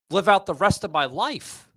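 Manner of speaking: usual speaking voice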